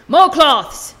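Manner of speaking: loud, high urgent order; urgent shout. Acted.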